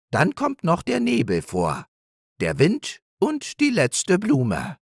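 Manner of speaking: high, modestly proud hare voice; proud hare Nepomuk. Acted. Hare telling his wife about his play, proudly.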